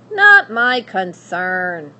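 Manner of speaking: loud, very slow dismissal; cold witch. Acted. Witch refusing the hungry princess, loud and slow. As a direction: shut her down flatly.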